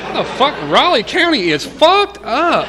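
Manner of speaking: loud, high-pitched comic rant; incredulous outrage. Retold or imitated. Man on probation reacting to shot ex-cellmate, incredulous retold rant. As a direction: rant in disbelief, voice pushed way up.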